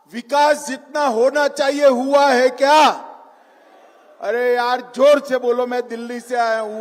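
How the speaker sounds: rousing crowd-pumping oratory; booming political rally speech. Real, not acted. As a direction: call out to the crowd from the stage.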